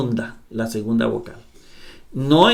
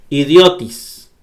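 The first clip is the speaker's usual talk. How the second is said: idiotis with a drawn-out, louder stressed O: idiÓtis; stress on the accented O; 5 dB louder, slower than usual. Shown as a demonstration. Teacher demonstrating where the Greek accent falls, stress idiÓtis. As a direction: say idiotis slowly, leaning on the middle O. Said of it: "El acento va aquí".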